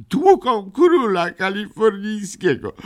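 high, slow, tearful wail; a lightly voiced sob; a hint of a crying little girl; sobbing. A narrator's reading. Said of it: "szlochała panna Migotka".

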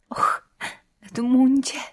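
high, stifled cry of distress; a gasp, then the name rising high; quiet cry. A narrator's reading. Łucja on the verge of tears, crying out softly. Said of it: "Łucja krzyknęła cicho".

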